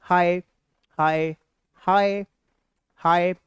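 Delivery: drawn-out slow हाय, हाय; playful slow hi demo; slow varied greetings. Shown as a demonstration. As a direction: stretch each हाय slowly in a new style. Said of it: "अलग-अलग style से".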